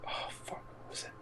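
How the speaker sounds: whispered curse, unvoiced; whispered to self. Real, not acted. Trying to remember the costume name, whispered under the breath. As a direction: whisper it to yourself in frustration.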